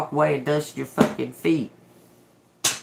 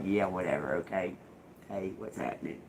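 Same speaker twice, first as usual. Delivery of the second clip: low, much softer throwaway tone; casual indifference; 9 semitones lower, 10 dB quieter than usual. Pretend. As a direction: toss it off like you don't care. Acted shrug at rude people.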